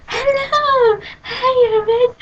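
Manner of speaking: very high, sing-song mock greeting; imitating the girl. Imitating the creepy smiley girl greeting her, very high sweet voice. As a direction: squeak out an overly sweet hello.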